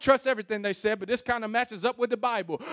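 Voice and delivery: ordinary talk, nothing marked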